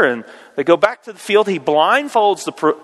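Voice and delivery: ordinary delivery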